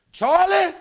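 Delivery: a father's sharp call of a name. Retold or imitated. Father at the store calling his name, a firm call. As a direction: call the name out to settle him. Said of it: "he kept saying".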